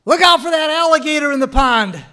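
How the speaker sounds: high, loud shout of alarm for a joke; yelled mock warning. Jokester guest to a ten-year-old by the pond, yelled mock warning. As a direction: holler it like a prankster springing up.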